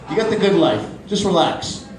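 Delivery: ordinary talk, nothing marked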